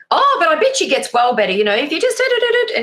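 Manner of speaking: high, loud chirpy cheer-up voice; pep her right back up; upbeat reassurance. Retold or imitated. Friend cheering up the worried mother, chirpy.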